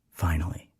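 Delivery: soft breathy whisper. A narrator's reading. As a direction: whisper it like a secret. Whispered thought.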